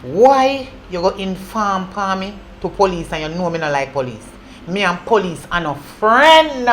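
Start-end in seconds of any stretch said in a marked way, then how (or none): 4.7-6.7 slow menace. Acted. Angry captor confronts Toby.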